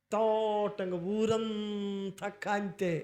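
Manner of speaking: very slow, stretched proclamation; drawn-out proclamation. Acted. Preacher to congregation, slow stretched proclamation. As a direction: stretch each word out slowly.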